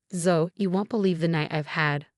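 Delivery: usual speaking voice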